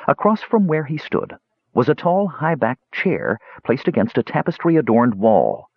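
ordinary delivery

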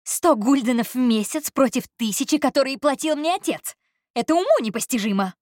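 a spoilt heiress crying out at the injustice; loud outraged protest. Acted. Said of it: "Её голос был столь громким".

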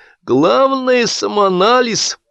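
high, drawn-out sage tone; drunk pronouncement. A narrator's reading. Drunk Kytin breaking the silence, pompous.

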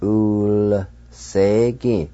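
Ulsegi stretched out very slowly; very slow Cherokee word. Shown as a demonstration. Teacher slows Ulsegi right down for beginners. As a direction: draw out each sound of Ulsegi. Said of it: "You just pronounce the L sound like this".

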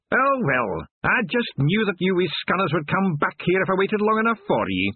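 high, gleeful Scottish Feegle; Scottish watchman voice. A narrator's reading. Voice in the dark.

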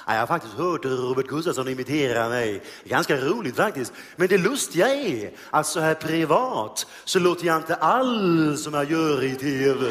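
deadpan and a bit puzzled; Bertil Svensson voice. Retold or imitated. Comedian quoting Bertil Svensson's radio interview, slow and dry. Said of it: "Han sa".

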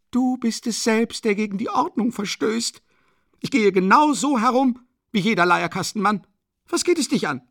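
high, loud, quarrelling woman's voice; indignant widow. A narrator's reading. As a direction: throw the charge back at him, high and loud. Katharina snaps back at policeman. (empörte Witwe, hoch und laut streitend)

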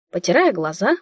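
ordinary delivery, nothing marked

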